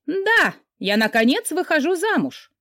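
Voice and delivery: high, elated proclamation; triumphant announcement. A narrator's reading. Friend announcing she is finally getting married, triumphant announcement. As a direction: burst out with it, pitched high. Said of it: "Карина победно потрясла кулаками".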